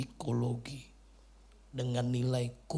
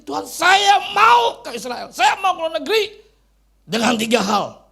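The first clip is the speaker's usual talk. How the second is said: high, very loud, insistent plea; shouted prayer; 14 semitones higher, 14 dB louder than usual. Retold or imitated. Preacher re-enacting his bold prayer to God, shouted prayer. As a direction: shout the demand up to God, drawing it out. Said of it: "saya berdoa begini".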